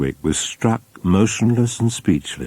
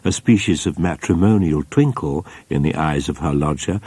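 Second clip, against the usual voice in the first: ordinary delivery, nothing marked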